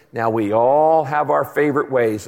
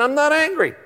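high-pitched, indignant denial; squeaky-high retold protest; exasperated mock protest; 10 semitones higher than usual. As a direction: act out a patient losing his cool, voice shooting up. Acted escalating denial to psychiatrist.

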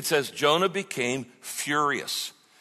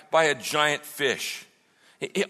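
ordinary delivery

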